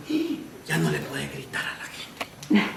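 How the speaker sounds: a timid HR man whispering a warning; hushed warning. Retold or imitated. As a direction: hush him like a nervous HR clerk. HR man stops the yelling manager.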